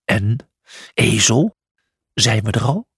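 grumpy lion just woken; understated sleepy-lion grumble; mild growl. A narrator's reading. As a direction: grumble it, annoyed. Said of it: "gromde naar Julius".

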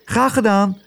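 hoge vogelstemmetjes; roepende vogels. A narrator's reading. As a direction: roep het hoog, als een vogeltje. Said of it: "riepen de vogels". (hoog roepende vogels)

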